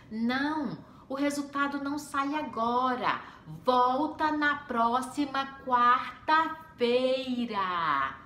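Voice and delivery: slow, every syllable spelled out; over-pronounced, drawn-out final line; over-articulating secretary. Retold or imitated. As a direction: mimic someone talking down to a foreigner. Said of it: "com a boca bem pronunciando todas as palavras".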